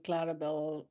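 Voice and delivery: ordinary talk, nothing marked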